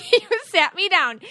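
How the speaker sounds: laughing as she recalls being sat down. Real, not acted. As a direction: giggle it out while telling. Laughing while retelling the story.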